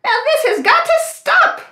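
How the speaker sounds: very high, alarmed duck squawk; startled cartoon duck, quick and high-pitched; Sally. A narrator's reading. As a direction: quack in protest, high and loud. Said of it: "quacked Sally".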